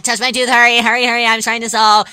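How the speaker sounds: loud hurried calling; rushing the friend, loud and high; urgent yell. Acted.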